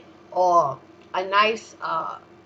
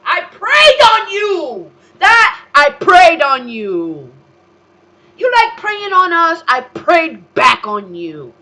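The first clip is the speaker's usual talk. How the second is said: high, tearful scream much louder; crying shout; 9 semitones higher, 11 dB louder, slower than usual. Real, not acted. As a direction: cry out the defiance at full voice. Ranting at enemies, crying out.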